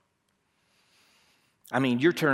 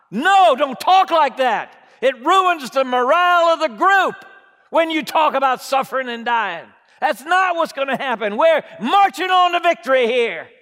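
loud, higher scolding rebuke; harsh scolding; 8 semitones higher, 7 dB louder than usual. Acted. Peter scolding Jesus about suffering, harsh and loud. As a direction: scold him for talking of death. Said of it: "scolded him harshly".

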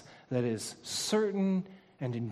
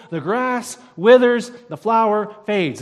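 ringing proclamation; 7 semitones higher, 13 dB louder than usual. Acted. Pastor thundering Isaiah's words at the congregation, loud proclamation. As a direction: proclaim it like a verdict over the crowd. Climax of preached quote.

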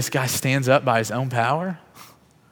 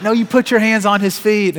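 much higher and louder, voiced as the wife; eager insistence; 9 semitones higher, 7 dB louder than usual. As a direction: urge him on excitedly. Wife urges him on.

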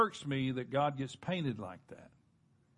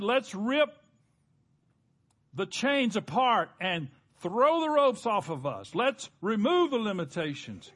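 higher-pitched rallying cry of revolt; defiant rebels; 5 semitones higher, 4 dB louder than usual. Acted. Preacher quoting rebels throwing off ropes, acted defiant cry. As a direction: voice rebels urging each other to break loose.

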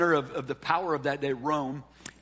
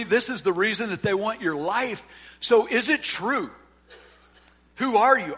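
higher, louder, pressing demand; Pilate interrogating; 5 semitones higher, 5 dB louder than usual. Acted. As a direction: corner him, demand who he is. Pastor acts out Pilate's questioning.

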